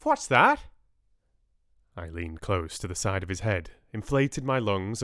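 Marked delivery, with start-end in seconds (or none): 0.0-0.7 loud, very high query; sharp, high-pitched deaf man's question; hard-of-hearing client. A narrator's reading. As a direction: ask loudly, not having heard. Deaf client asks for a repeat.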